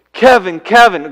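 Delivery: loud, high call, name twice; God calling out. Pretend. God calling Kevin again, loud and high. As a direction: call out from above, ringing.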